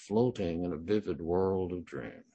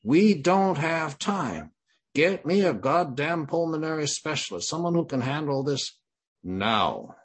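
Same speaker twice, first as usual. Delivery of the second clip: retold scream; 9 semitones higher, 7 dB louder than usual. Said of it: "so I scream".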